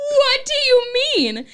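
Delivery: retold high wail of innocence; mock indignant protest. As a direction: squeal it in hammed-up disbelief. Acting out her own indignant reply.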